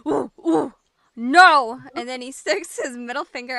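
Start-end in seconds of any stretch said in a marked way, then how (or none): 0.1-1.8 loud hooting ape cry; monkey voice. Acted. GM voicing the monkey refusing the party, loud hooting ape. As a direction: hoot it out like an angry monkey. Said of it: "looks at you and goes".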